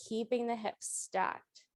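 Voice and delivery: usual speaking voice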